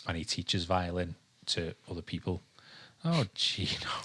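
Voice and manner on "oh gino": whisper to dog. Real, not acted. Talking to the dog.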